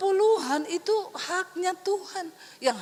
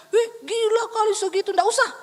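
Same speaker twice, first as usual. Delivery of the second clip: shocked husband; 5 semitones higher, 5 dB louder than usual. Retold or imitated. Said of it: "berharap suami saya bilang".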